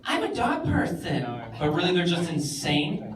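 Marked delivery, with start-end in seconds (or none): 0.1-1.2 smug dog person. Retold or imitated. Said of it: "the kind of person who's like".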